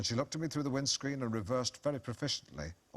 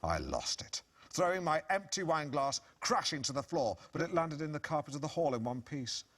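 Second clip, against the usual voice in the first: ordinary talk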